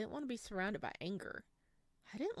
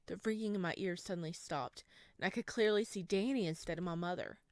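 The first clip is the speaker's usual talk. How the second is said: usual speaking voice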